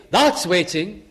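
ordinary talk, nothing marked

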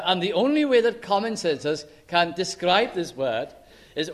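ordinary delivery

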